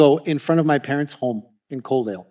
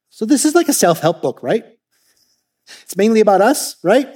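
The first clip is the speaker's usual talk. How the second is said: sarcastic; 6 semitones higher than usual. Retold or imitated. Louisa yanking the pastor's chain, sarcastic tease. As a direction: needle the pastor playfully. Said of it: "she goes sarcastically".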